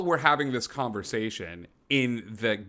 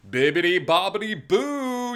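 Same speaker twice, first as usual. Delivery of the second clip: slow sing-song flourish; playful sing-song; 8 semitones higher, 3 dB louder than usual. Pretend. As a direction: draw it out like a fairy godmother. Player hams a magic flourish.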